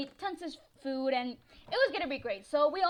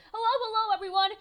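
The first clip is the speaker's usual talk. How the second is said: hello hello, pitched up and loud; high energetic greeting; 8 semitones higher, 7 dB louder, faster than usual. Real, not acted. Vlogger welcomes everyone with high, loud excitement. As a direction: call out to the audience cheerfully.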